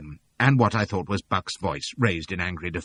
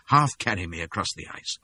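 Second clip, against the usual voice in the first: plain reading voice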